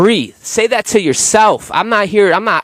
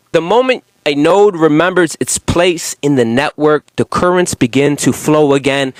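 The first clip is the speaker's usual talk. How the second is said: normal talking voice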